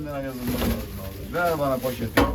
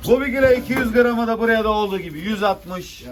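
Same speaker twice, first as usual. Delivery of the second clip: loud auction call; auctioneer; 4 semitones higher, 4 dB louder than usual. Real, not acted. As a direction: call the lot to the room. Fish auction bidding call.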